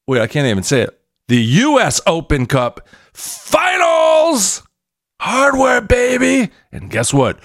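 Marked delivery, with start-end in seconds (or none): 1.3-4.6 excited shout. Real, not acted. Hyped fan announcing cup final. 5.2-6.5 excited cheer. Real, not acted. Celebrating winning trophies.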